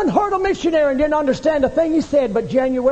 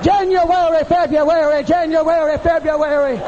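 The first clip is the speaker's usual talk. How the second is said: raised, falling cry on each month; fervent crying out; 4 semitones higher than usual. Acted. Preacher re-enacts the woman's prayer, fervent crying out. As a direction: chant the months out like a desperate prayer. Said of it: "she just said".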